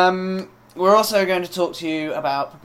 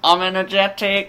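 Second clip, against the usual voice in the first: mock CV applicant; 3 semitones higher than usual. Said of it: "Stick that on your C V".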